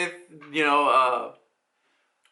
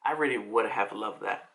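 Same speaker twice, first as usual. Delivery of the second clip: low, softer, wistful-thought voice; inner thought; 10 semitones lower, 5 dB quieter than usual. Acted. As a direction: say it inwardly, pitched well down. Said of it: "he thinks in his head".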